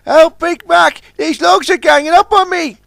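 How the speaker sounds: high, loud panicked cry; cry for help. Acted. Trapped Sunshine cries to Big Mac, high panic. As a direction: yelp as the logs close in. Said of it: "he cried".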